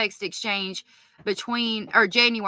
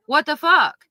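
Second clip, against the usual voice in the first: higher and louder, shocked disbelief; outraged outburst; 6 semitones higher, 6 dB louder than usual. Real, not acted. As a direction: let the outrage burst out. Outraged at what she just read.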